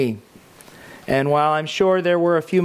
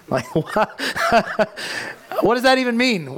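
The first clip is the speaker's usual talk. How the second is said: laughing disbelief; 7 semitones higher than usual. Real, not acted. Baffled laugh at God's phrase.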